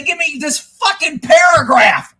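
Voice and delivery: loud, high, exasperated burst; real irritated shouting; exasperated outburst. Real, not acted. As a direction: spit out your frustration at full volume. Ranting about badly sent comments.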